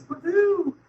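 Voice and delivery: high, giddy woo-hoo; mock celebration. Patient joking about wonderful pain meds, mock celebration. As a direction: whoop it up in mock delight.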